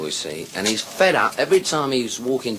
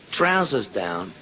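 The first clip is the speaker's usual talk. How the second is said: ordinary talk, nothing marked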